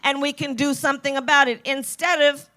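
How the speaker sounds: loud, punchy stump speech; rally speech. Acted. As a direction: fire up the crowd point by point. Rallying the crowd.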